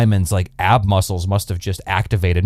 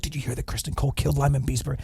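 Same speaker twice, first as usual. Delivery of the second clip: gossiping rumor; 3 semitones higher, 10 dB quieter, faster than usual. Retold or imitated. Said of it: "Everybody's spreading it around".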